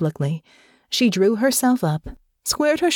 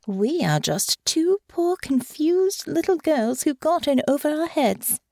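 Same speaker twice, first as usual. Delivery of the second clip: mock helpless girl; 10 semitones higher than usual. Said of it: "Honor thought sardonically".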